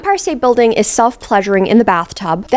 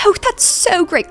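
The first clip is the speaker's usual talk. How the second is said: much higher, slow, insincere cheer; fake enthusiasm; 10 semitones higher than usual. Family pretending to be happy Pamela is leaving, fake cheer. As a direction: say it glad to be rid of her. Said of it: "You can tell by their tone of voice".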